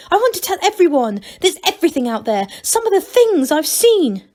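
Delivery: high, quick, eager; tumbling out words in delight; feverish excitement. Acted. Sick Angelo bursting to tell everyone, high and fast.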